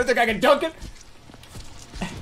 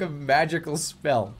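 usual speaking voice

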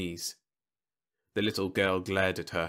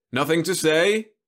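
smooth robot salesman; 9 semitones higher, 8 dB louder than usual. A narrator's reading. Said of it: "asked Roby".

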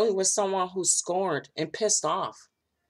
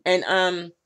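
normal talking voice

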